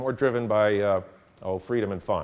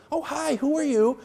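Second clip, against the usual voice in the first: put-on bubbly girl. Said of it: "and she goes".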